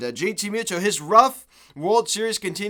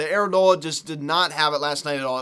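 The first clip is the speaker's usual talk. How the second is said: normal talking voice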